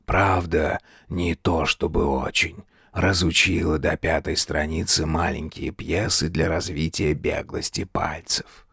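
low, soft ghostly voice; ghost of a dead king. A narrator's reading. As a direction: murmur low, like a shade commenting unheard.

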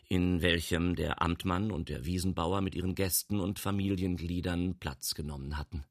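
usual speaking voice

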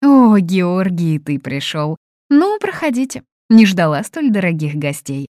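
high, loud opening exclamations; effusive welcome. A narrator's reading. Hostess welcomes guests she cannot feed, effusive. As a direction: greet guests with a theatrical, swooping welcome.

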